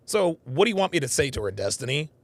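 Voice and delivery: normal talking voice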